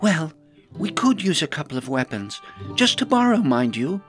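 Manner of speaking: high, halting admission; awkward, wheedling request; sheepish. A narrator's reading. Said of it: "looked rather sheepish and said".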